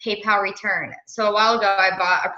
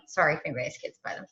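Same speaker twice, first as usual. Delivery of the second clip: quick quiet aside; 6 semitones lower, 6 dB quieter, faster than usual. Real, not acted. Host apologizing to the team for swearing, quick and quiet. Aside about kids listening.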